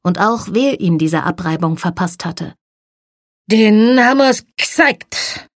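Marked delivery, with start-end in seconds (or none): 3.5-5.5 put-on slow, slurred and loud, a high drunk boast; drunken slurring. A narrator's reading. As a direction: slur it like a drunk bragging. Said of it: "lallte er". (betrunken lallend)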